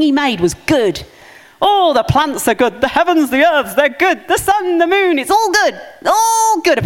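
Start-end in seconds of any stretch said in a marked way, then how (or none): 1.7-6.8 rising higher and higher with joy; building delight. Acted. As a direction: marvel more with each line. God admiring creation, rising excitement.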